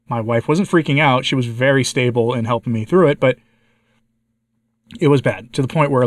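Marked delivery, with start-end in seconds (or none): none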